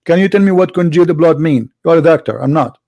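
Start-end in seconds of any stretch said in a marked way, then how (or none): none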